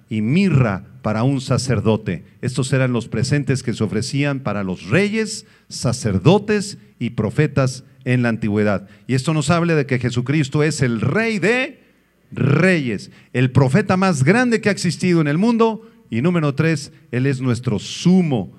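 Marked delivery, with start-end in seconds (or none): none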